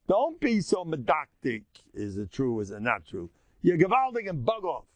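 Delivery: ordinary talk, nothing marked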